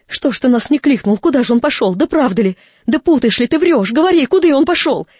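loud, agitated volley of questions; urgent questioning. Acted. Mother demands where the visitor went, urgently.

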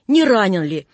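usual speaking voice

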